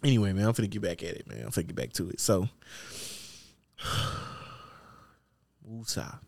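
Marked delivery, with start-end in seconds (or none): 3.8-6.2 soft, low breath out; hushed self-calming mantra; calming exhale. Real, not acted. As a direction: sigh the word out to settle yourself. Calming himself to stop laughing.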